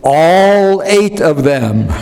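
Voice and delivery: the comic lands the joke on the crowd, slow and deadpan; deadpan punchline. Retold or imitated. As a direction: let the last line land slowly as the comic. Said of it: "And then he says".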